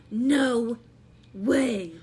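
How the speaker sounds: loud, stunned no way; amazed gasp. Acted. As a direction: drop your jaw at the discovery. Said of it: "and she's like, gasps".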